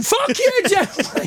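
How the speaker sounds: high-pitched mock heckle, drawn out; mock outraged yell, pitched far up; imitated angry women. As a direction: impersonate women jeering from the audience. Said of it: "women standing up going".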